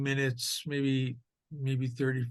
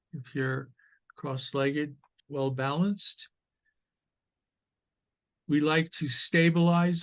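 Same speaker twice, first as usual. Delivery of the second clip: measured meditation guide with long pauses; guided meditation. Acted. Teacher leading sitters into awareness of the breath, guided meditation voice. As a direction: guide listeners gently through posture and breath, unhurried.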